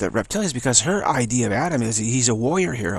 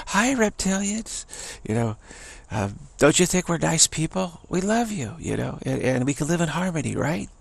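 sweet-talking Eve; 5 semitones higher than usual. Retold or imitated. Eve greeting the reptilians, syrupy higher voice. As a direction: coax them with fake sweetness.

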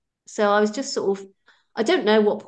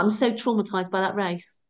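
normal talking voice

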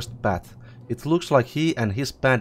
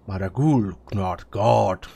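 troll voice; 3 semitones lower than usual. Acted. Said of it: "troll says".

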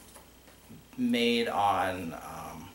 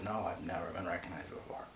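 whispering his quiet reply; hushed, like a shy confession; retold whisper; 4 semitones lower, 11 dB quieter than usual. Telling cohost how the YouTuber whispered, hushed retelling.

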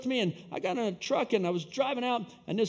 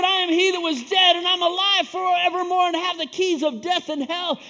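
high, loud, triumphant proclamation; full-force preaching; 9 semitones higher, 10 dB louder than usual. Real, not acted. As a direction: thunder it out to the congregation.

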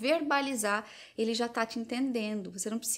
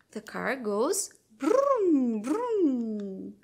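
put-on playful child-directed speech; 2 semitones higher, 3 dB louder, much slower than usual. Shown as a demonstration. Mother shows a toddler a toy car, slow sing-song. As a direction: draw the child into play. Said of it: "tente dizer".

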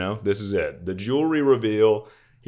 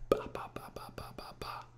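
whispered babble; 15 dB quieter, faster than usual. Acted. Nonsense syllables, no comment.

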